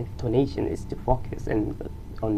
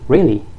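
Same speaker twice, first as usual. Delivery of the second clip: surprised disbelief, sharply rising "Really?"; sharp rise; 3 semitones higher, 12 dB louder than usual. Shown as a demonstration. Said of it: "the pitch goes sharply up".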